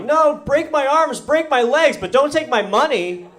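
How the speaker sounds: high, pleading, hammed-up anguish; melodramatic plea. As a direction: beg dramatically, pitch way up. Mock plea to muggers.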